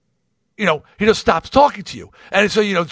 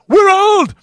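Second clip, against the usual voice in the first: imitating a voicemail greeting, shouted much higher and louder; mock voicemail shout; 8 dB louder than usual. Howard's voicemail greeting mimicked by the caller, a high loud shout. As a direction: belt it out like a goofy answering-machine greeting.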